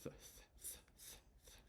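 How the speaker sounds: very soft repeated "th" with no vowel after it; isolated TH sound. Shown as a demonstration. Teacher showing students the TH sound, isolated and repeated. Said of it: "But the sound is".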